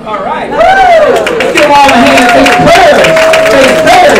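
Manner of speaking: very high whoop, then loud calls; cheering the room. Real, not acted. Host cheering the crowd on, loud and high. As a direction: whip up the cheer.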